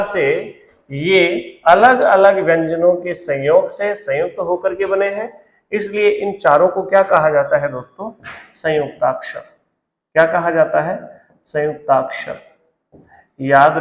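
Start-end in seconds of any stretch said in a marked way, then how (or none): none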